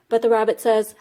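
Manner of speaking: usual speaking voice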